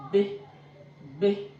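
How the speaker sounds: "B" said as a short "beh"; letter-sound demo. Shown as a demonstration. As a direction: sound out "B" as "beh", not "bee". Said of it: "B is pronounced as".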